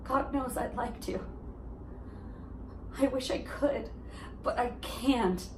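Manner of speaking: soft, pained confession; hurt admission. Acted. Monologue to ex-boyfriend, softening.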